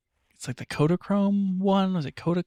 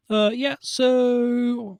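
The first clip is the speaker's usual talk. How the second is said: slow, higher pilot intercom voice; mock captain announcement; airline pilot; 8 semitones higher, much slower than usual.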